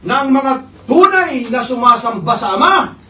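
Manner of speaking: preacher booming the verse; preaching at full force. Acted. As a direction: proclaim it slowly to the church. Preacher proclaims Jesus' words.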